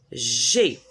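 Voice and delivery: "G" pronounced as a hard g sound, low; G said as a hard "gue". Shown as a demonstration. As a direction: model the hard "G" sound for the class. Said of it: "comment on prononce".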